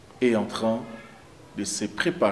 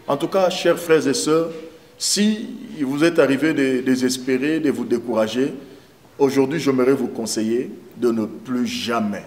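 rising, impassioned sermon voice; fervent preaching; 5 semitones higher, 6 dB louder than usual. Acted. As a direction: preach it out with growing fire.

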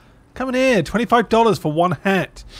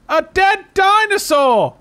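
high, slow, loud cry of horror; dramatic shout; 13 semitones higher, 8 dB louder, slower than usual. Pretend. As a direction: wail it like a disaster movie. Reacting to his park in the game.

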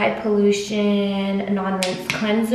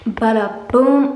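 bada boom flourish; 8 dB louder, slower than usual. Real, not acted. Finishing skincare step flourish.

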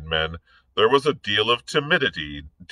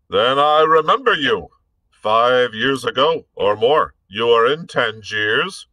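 bullying officer voice, loud and scornful; blustering captain; 4 semitones higher, 5 dB louder than usual. Acted. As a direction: bark it like a brutish dragoon. Hobart taunting Blood.

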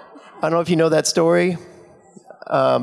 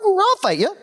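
Osric accepting. Retold or imitated. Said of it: "Osric goes".

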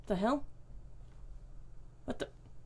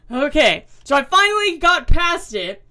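relieved excitement; 4 semitones higher, 7 dB louder than usual. Real, not acted. Finally passes the hard section.

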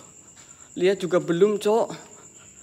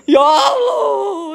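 high, loud, long drawn-out wail of fear; real scared shout of God's name; frightened cry; 12 semitones higher, 8 dB louder, much slower than usual. Real, not acted. Frightened outcry at noise.

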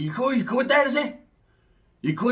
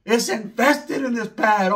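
ordinary delivery

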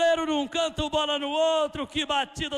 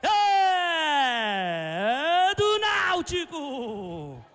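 drawn-out goal call; much slower than usual. Real, not acted.

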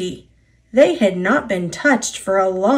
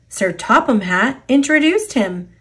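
plain reading voice

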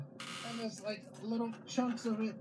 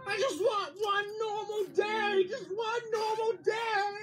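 high, loud, slow wail of despair; wailing cry; 9 semitones higher, 5 dB louder, slower than usual. Real, not acted. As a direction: cry out, at the end of your rope. Mom breaking down over the mess.

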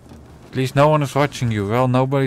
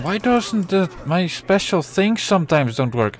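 usual speaking voice

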